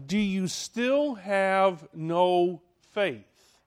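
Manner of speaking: drawn out slowly, each word weighed; slow, weighty rebuke; slow solemn question. Acted. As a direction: ask it slowly like a grieved teacher. Preacher voicing Jesus' question.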